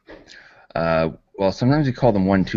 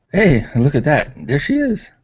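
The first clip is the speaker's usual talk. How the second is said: much higher, bright surprise as Jenny appears; excited greeting; 10 semitones higher, 3 dB louder than usual. Real, not acted. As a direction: call out delighted to a friend arriving. Jenny suddenly joins the call.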